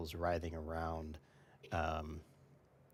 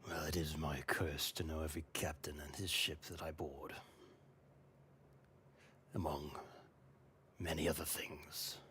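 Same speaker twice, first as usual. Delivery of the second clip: cursed pirate captain; 2 semitones lower, 6 dB quieter than usual. Acted. Game master voices the cursed pirate.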